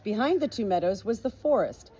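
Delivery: ordinary delivery, nothing marked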